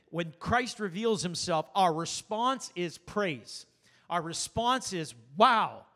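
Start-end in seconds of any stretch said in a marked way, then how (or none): 5.4-5.9 loud sudden wow of wonder; awed wow. Retold or imitated. Preacher voicing a worshipper's awe to the congregation, loud amazed wow. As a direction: burst out in amazed praise.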